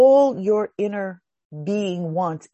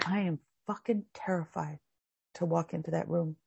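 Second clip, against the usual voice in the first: scared confession; 3 semitones lower, 11 dB quieter than usual. Acted. Frightened inner being speaks.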